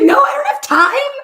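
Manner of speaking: high, loud, half-laughing; exasperated refusal. Retold or imitated. Patient refuses meditation to her doctor, loud and high. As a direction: toss it off, frantic.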